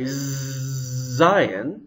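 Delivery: "zayin" with a long buzzing z; voiced, vibrating z. Shown as a demonstration. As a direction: hold the voiced z before saying "zayin". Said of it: "there are lots of vibrations".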